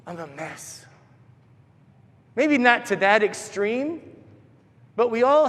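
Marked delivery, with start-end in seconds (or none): 0.1-0.9 very soft, defeated admission; dejected inner voice. Retold or imitated. Us judging ourselves as not enough, dejected and hushed. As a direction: let it drop to a small, quiet confession. Voicing a self-critical thought.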